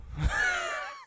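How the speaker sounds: a short, high, embarrassed laugh; cracking up at his own voice. Real, not acted. Player laughing at his bad accent, high embarrassed laugh.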